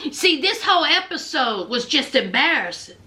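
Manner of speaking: normal talking voice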